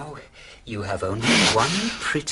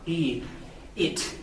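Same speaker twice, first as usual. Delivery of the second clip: normal talking voice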